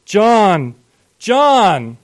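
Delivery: high, loud calling out; angry yell. A narrator's reading. Father outside yells for his son, furious. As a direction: yell the name up at the house. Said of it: "he yelled".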